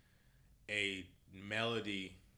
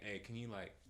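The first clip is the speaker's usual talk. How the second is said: soft, hesitant ask; humble request; 9 dB quieter than usual. Retold or imitated. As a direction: retell a humble favor, much quieter. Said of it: "the most humble way".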